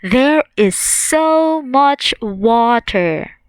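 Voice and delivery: drawn out, teacher modelling; slow, clear classroom model; slow model sentence. Shown as a demonstration. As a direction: say it slowly for students to copy. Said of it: "We can say".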